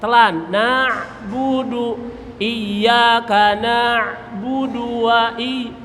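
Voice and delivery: na'budu said slowly, ain swallowed; slow recitation. Shown as a demonstration. Teacher drills the ain slowly. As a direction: draw out na'budu from the throat. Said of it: "wawunya monyong".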